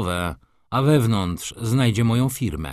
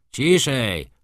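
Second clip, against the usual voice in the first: sharp call for silence; a judge calling the room to order; 3 semitones higher, 4 dB louder than usual. A narrator's reading. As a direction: hush the courtroom firmly. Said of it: "zawołał sędzia".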